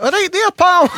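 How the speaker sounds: high-pitched mock Scottish accent; imitated Scot on the phone, loud and high. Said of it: "Scottish people who do that".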